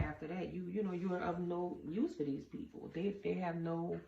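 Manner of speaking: ordinary delivery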